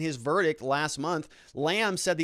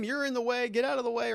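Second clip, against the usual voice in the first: higher, half-hearted retold yell; mock shout back; 10 semitones higher than usual. Retelling the bystander shouting at the officer, a mild mock yell. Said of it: "the other guy's shouting back at him".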